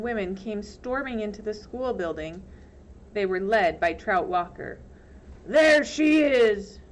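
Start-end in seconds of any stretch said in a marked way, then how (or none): 5.5-6.8 loud, high, slow accusing shout; drawn-out furious yell; angry shout. Acted. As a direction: yell it out to the mob. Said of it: "Trout shouted".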